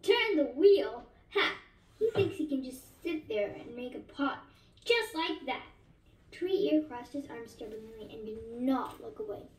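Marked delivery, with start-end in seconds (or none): 0.0-5.7 put-on old potter scorning a beggar boy's claim, derisive; mocking derision. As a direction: sneer at the boy's boast with a mock laugh. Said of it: "spoke with derision".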